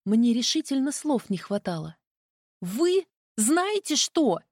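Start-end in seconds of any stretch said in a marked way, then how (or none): 2.6-4.4 slow, higher sputtering outrage; indignant. A narrator's reading. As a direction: stammer it out, offended. Flustered heroine sputters.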